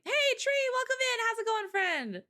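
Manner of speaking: squeaky high welcome; high-pitched greeting. Real, not acted.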